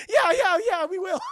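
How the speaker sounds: high, loud gushing agreement; hammed-up flustered yeses breaking into laughter; overeager Goliath.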